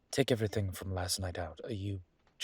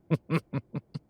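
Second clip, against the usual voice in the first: high breathy giggle, falling away; laugh; 7 semitones higher, 4 dB louder than usual. Acted. Professor catching herself flirting, high falling giggle. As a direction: giggle at your own boldness.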